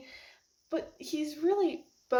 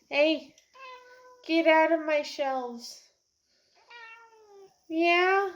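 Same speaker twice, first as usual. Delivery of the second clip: louder, sharp telling-off of the pet; scolding a pet; 5 semitones higher, 4 dB louder than usual. Real, not acted. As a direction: shoo the pet off the shelves. Scolding pet Sam on shelves.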